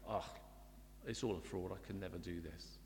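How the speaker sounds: low, quiet defeated self-talk; downward spiral. Acted. Preacher voices downward spiral of self-examination, low and defeated. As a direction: sink into hopeless self-reproach, voice dropped. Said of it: "not as a downward spiral to say".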